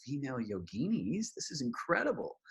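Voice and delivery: awed, hushed then soaring on incredible; amazed. Retold or imitated. To her dear friend, awestruck whisper-to-gasp. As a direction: marvel at it breathlessly. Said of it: "I was like".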